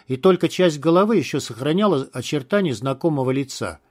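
ordinary delivery, nothing marked

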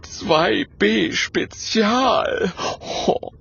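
high, very slow and savoured; awed little ghost showing off; proud wonder. A narrator's reading. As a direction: relish the title proudly. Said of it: "murmelt Gerd stolz".

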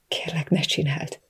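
soft, voiceless begging; whispered plea. A narrator's reading. Captive begging the man to stop, whispered plea. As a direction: plead in a helpless whisper.

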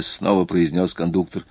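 ordinary delivery, nothing marked

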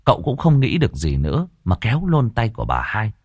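usual speaking voice

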